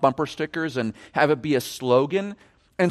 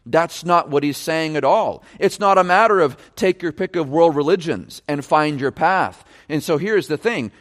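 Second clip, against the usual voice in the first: ordinary talk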